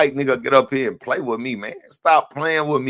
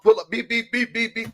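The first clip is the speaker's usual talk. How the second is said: horn beeps; 6 semitones higher, 4 dB quieter than usual. Retold or imitated. Imitating a car horn.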